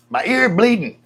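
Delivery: high, loud playful cry for help; mock alarmed yell. Streamer calls Lynn about his bleeding ear, mock alarmed yell. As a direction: yell for your partner in pretend panic.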